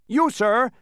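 high, loud outburst; very excited shout. A narrator's reading. Bee interrupts the Humbug, excited shout. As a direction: shout it at him, worked up. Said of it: "shouted the bee very excitedly".

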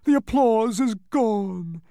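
high, slow, loud wail; disappointed complaint. A narrator's reading. Humbug losing his cheering crowd, disappointed complaint. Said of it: "complained the disappointed humbug".

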